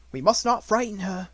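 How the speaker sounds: ordinary delivery, nothing marked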